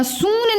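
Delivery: सून with a high, stretched oo; the mistaken सून, oo held long; wrong long vowel. Shown as a demonstration. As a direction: lift and lengthen the vowel of सून. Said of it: "नहीं हम बोलते".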